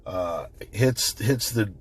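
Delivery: ordinary talk, nothing marked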